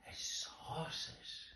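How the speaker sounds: a slow breathy whisper; hushed whisper. A narrator's reading. Reading to children, whispering the scary moment. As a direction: hush it down to a whisper. Kittens peering scared.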